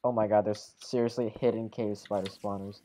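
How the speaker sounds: ordinary talk, nothing marked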